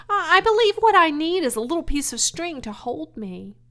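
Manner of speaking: high, loud eager piglet; eager pig Wilbur. Acted. Wilbur explaining his plan to Charlotte, eager.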